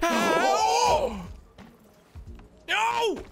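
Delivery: high, loud yelp of dismay; real startled shout. Real, not acted. Player caught out mid-game, startled high shout.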